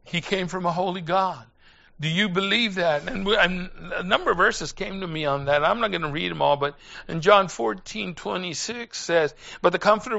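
usual speaking voice

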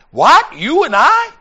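loud, high imitation of a shocked man; mock-incredulous outburst, voice shooting up; startled disbelief. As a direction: blurt it out, aghast at the idea. Companion startled by street meeting plan.

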